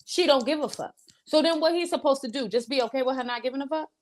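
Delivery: ordinary talk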